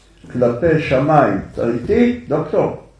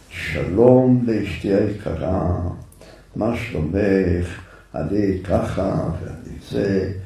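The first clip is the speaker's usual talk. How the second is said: gentle letter reading; 4 semitones lower, slower than usual. Retold or imitated. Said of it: "איך צעק עליה במכתב?".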